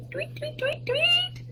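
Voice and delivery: high whispered chirps; whispered tweets. Pretend. Whispered bird imitation.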